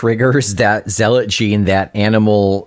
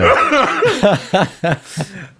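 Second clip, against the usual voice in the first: high giggling laugh; 10 semitones higher than usual. Real, not acted. Laughing at accent bit.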